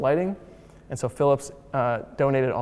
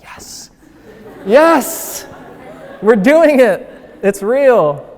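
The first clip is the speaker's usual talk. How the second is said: much higher, louder, bursting with excitement; excited yes; 10 semitones higher, 10 dB louder, much slower than usual. Retold or imitated. Telling the kids their building is designed, thrilled and loud. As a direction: cheer them on like it's the best news ever.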